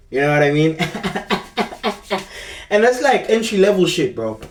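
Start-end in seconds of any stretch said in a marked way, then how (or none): 0.8-2.7 silent breathy laugh. Real, not acted. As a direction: laugh out without voice. Laughs at the story.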